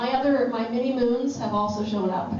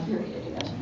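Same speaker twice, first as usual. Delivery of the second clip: unimpressed whisper; 6 dB quieter than usual. Retold or imitated. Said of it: "look at me like".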